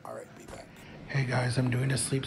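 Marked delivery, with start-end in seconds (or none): 0.0-0.7 whispered aside. Real, not acted. Signing off quietly before sleep test.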